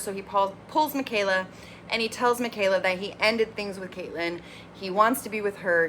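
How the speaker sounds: ordinary delivery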